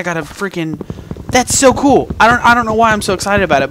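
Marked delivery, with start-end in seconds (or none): 1.3-3.7 loud, high, thrilled outburst; excited. Real, not acted. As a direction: gush over the new armour. Thrilled about new chest plate.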